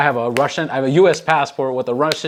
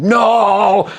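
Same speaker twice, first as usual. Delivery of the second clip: sharp high yelled no, much louder; retold scream; 10 semitones higher, 6 dB louder than usual. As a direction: shout it like there's a bomb. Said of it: "everybody at the same time screamed".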